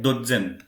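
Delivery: Dotzen with a short O and hard TZ; German pronunciation. Shown as a demonstration. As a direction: demonstrate Dotzen as DOT-zen. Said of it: "when you pronounce it".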